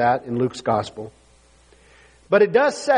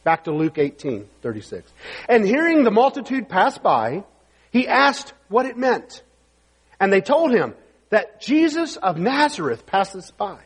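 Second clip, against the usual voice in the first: normal talking voice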